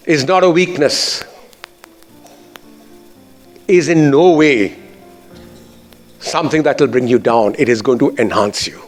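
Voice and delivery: normal talking voice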